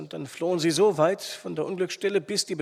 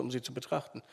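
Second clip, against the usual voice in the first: plain reading voice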